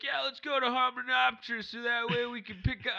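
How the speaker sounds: a southern politician rallying the party; talking like Bill Clinton to the group; Bill Clinton impression. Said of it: "I sound like Bill Clinton".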